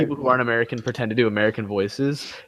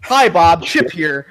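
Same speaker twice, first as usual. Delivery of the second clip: loud, high cheesy news anchor greeting; hammed-up newsreader voice, higher and louder; news anchor impression; 5 semitones higher, 5 dB louder, slower than usual. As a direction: boom out a cheesy anchor hello. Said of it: "He's like".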